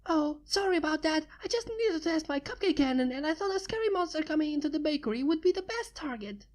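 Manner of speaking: high, quick cheerful pony voice; bubbly Pinky. Acted. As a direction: chirp it carelessly.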